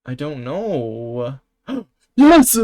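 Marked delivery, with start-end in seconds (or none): 1.7-2.6 sharp gasp into a loud, high "Yes!"; gasp then cheer. Real, not acted. Streamer cheering his correct guess, gasp and loud cheer. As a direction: cheer like you just won.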